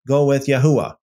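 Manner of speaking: usual speaking voice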